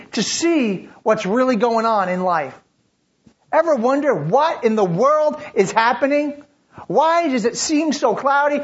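high, loud, urgent preaching questions; preaching at full force. Acted. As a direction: press the crowd with each question.

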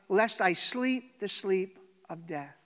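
loud, high, pleading prayer; impassioned prayer. Acted. Preacher voicing David, loud pleading prayer. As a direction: cry out to God at full force.

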